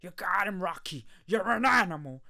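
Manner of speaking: loud, high hype shout from the corner; cheering corner man bellowing encouragement; coach shouting. Retold or imitated. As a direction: fire him up like a trainer in the corner. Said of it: "It's like".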